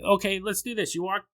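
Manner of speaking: ordinary talk, nothing marked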